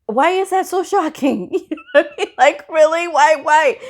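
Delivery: high-pitched, baffled retort; mock incredulity. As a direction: question their surprise, half amused. Retort to coworkers' shocked reaction.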